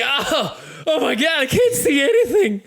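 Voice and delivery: high, loud wail of a blinded enemy; panicked wounded cry. Acted. Game master voices arrow-struck enemy with blood in his eyes, panicked cry.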